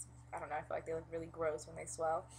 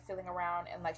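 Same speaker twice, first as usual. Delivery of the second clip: ordinary delivery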